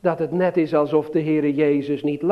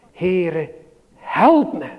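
loud prayer; much slower than usual. Retold or imitated. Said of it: "Net als Bartimaeus".

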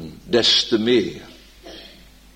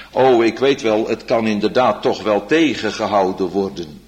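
ordinary talk

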